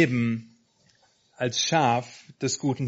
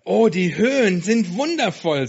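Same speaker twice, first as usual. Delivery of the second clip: enthusiastic; 5 semitones higher, 6 dB louder than usual. Acted. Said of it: "sagte Sammy".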